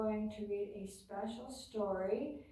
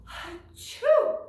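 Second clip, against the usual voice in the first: big hammed-up achoo, high and loud; loud fake sneeze; 11 semitones higher, 12 dB louder than usual. A narrator's reading. Sneeze read aloud.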